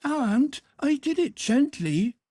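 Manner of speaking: put-on high boy's voice making excuses; pleading boy. A narrator's reading. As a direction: wheedle your way out of trouble. Boy excuses himself to housekeeper.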